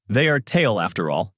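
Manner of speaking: usual speaking voice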